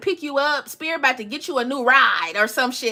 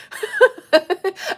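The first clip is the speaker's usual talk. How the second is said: short high laugh; a quick rising laugh; 8 semitones higher than usual. Real, not acted. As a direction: laugh at your own joke. Laughs at her own saying.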